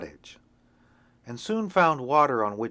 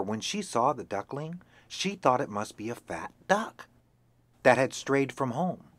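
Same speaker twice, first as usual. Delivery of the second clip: plain reading voice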